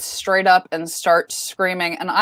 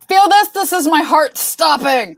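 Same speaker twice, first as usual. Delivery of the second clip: much higher and louder; retold alarmed outburst; 7 semitones higher, 5 dB louder than usual. Startled man to the screamer who woke Eric, alarmed outburst. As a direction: exclaim it in mock panic. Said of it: "And Eric's like".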